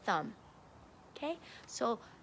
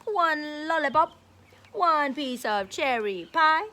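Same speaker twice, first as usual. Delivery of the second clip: put-on reading to children; 6 dB louder than usual. A narrator's reading. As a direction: read it out big for a class of kids. Reading picture book to kids.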